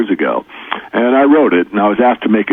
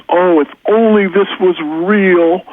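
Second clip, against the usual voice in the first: mother-in-law; 10 semitones higher, slower than usual. Retold or imitated. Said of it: "and she says".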